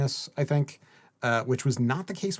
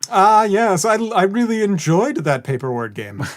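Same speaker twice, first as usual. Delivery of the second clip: mock highbrow; 7 semitones higher, 8 dB louder than usual. Mock-posh joke on books.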